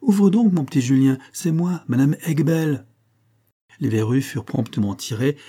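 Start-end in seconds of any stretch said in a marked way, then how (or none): 0.0-2.9 put-on loud, high call to the boy inside; calling through a door. A narrator's reading. Visitor calling to Julien through the locked door. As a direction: call out so he hears you.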